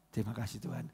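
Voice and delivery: low, very soft thanks; subdued, acted murmur of gratitude; brief thankful prayer. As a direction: quietly wrap up a prayer. Acts prayer after crying, then leaves.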